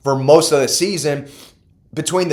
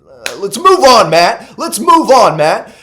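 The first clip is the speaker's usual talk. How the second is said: embarrassed move on; 6 semitones higher, 6 dB louder, slower than usual. Real, not acted. Laughing off his own slip.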